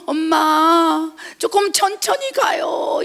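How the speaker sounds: put-on high, drawn-out child's plea; whiny child. A preacher voicing a child tugged along by the hand, drawn-out whine. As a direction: stretch it out like a tired kid begging.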